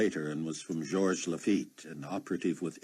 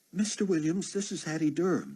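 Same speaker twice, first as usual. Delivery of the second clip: higher, bright female caller voice; chirpy flight attendant leaving a message; woman's voice; 12 semitones higher than usual. Acted.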